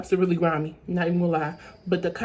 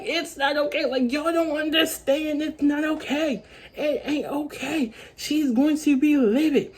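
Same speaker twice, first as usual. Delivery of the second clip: high, slow, tearful protest; retold crying; 6 semitones higher than usual. Girl crying to cops about her mom, tearful. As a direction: plead through mock tears. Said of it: "I have a breakdown. I start crying".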